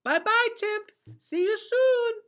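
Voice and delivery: put-on very high, slow little child voice; cheerful toddler. Toddler Boots waving goodbye to the Underlanders, cheerful. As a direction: chirp it like a happy toddler. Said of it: "waving cheerfully".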